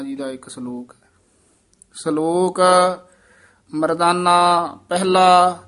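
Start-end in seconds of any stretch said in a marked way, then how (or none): 1.9-5.6 measured chanting recitation of Gurbani; scripture recitation. Shown as a demonstration. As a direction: recite each phrase slowly and evenly.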